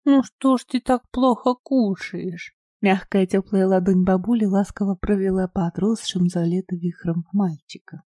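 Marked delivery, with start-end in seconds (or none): 0.1-2.6 gentle coaxing. A narrator's reading. Бабушка ласково уговаривает.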